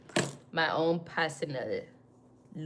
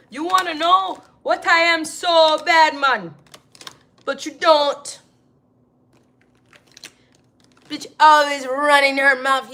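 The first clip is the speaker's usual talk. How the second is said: mock scolding; 8 semitones higher, 11 dB louder than usual. Mocking a nosy critic.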